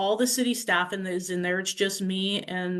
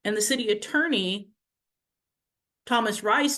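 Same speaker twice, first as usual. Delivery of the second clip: ordinary talk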